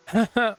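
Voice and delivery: high, loud scornful laugh; mocking laugh. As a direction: cackle at his empty threat. Sister mocks brother's threat.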